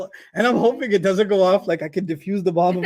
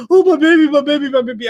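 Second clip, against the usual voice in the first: doting new parent; 8 semitones higher, 5 dB louder, faster than usual. Retold or imitated. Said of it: "you're like".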